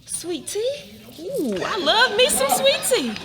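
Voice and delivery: high, loud, slow swooning over sweet tea; delighted. Acted. Young woman offered sweet tea gushes with delight.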